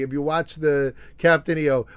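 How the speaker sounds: normal talking voice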